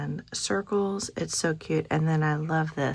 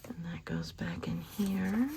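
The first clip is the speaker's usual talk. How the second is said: whispered aside; 8 dB quieter than usual. Real, not acted. Quiet aside putting item back.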